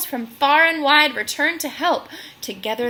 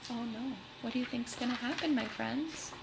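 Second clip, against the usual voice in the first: soft aside to listeners; 12 dB quieter than usual. Real, not acted. Reader pausing to ask the children, hushed and quick. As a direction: lean in and ask the little ones softly. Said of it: "reader's aside to children".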